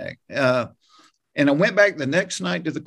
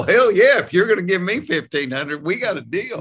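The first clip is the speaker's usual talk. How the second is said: retold eager yes, pitched up; delighted acceptance; 6 semitones higher than usual. Author jumping at the publisher's offer, retold delighted acceptance.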